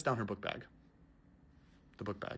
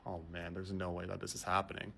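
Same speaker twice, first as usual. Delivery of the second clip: soft, low muttered dread; inner thought; 6 semitones lower, 9 dB quieter than usual. Retold or imitated. Passenger dreading the returning lady, soft inner voice. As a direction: think it to yourself under your breath. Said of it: "I'm thinking to myself".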